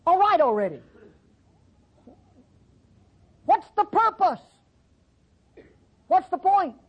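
high, pleading what's-the-point; exasperated Jeremiah. Acted. Preacher voicing Jeremiah asking God why, high exasperated.

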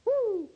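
high loud preacher's whoop; excited whoop. Real, not acted. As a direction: let out a sudden high whoop.

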